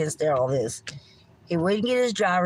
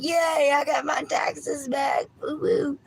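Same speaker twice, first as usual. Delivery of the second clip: high, mocking yay-I-got-my-taxes-back cheer; sarcastic whoop, pitched way up; 9 semitones higher than usual. Mocking taxpayers who cheer over their refund, high sarcastic whoop. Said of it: "and you're like".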